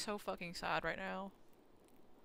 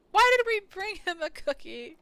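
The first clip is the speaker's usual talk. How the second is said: shouted, much higher; loud exasperation; 10 semitones higher, 12 dB louder than usual. Real, not acted. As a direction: yell it in mock despair. Reacting to the game scene.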